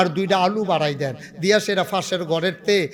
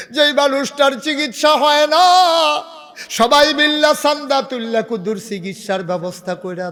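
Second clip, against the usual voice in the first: high, loud preaching cry; soaring sermon appeal; impassioned exhortation; 9 semitones higher, 6 dB louder than usual. Real, not acted. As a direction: urge the congregation at full voice. Preacher exhorts crowd to help.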